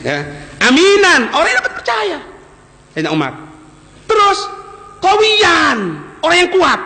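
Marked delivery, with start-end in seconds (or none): 0.6-2.2 emphatic proclamation. Real, not acted. Preacher proclaims Umar's trait. 4.1-6.8 emphatic proclamation. Real, not acted. Preacher proclaims Umar's strength.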